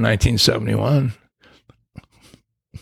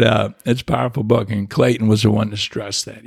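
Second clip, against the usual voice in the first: ordinary delivery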